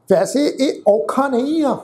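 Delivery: usual speaking voice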